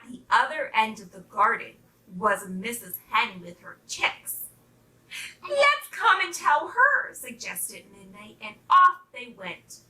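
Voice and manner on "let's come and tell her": high, eager kitten voice; high-voiced keen little kitten. A narrator's reading. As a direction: suggest it eagerly, pitched up high. Kitten eagerly suggests spreading the joke.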